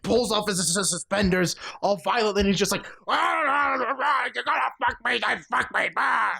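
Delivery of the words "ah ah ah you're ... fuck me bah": high, garbled strained holler; hammed-up babbling shout without full volume; mock frantic yelling. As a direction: imitate a man raving wildly. Imitating the frantic movie character yelling.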